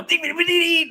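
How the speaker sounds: high, loud scatted syllables. Retold or imitated. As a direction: belt it out like a rock showman.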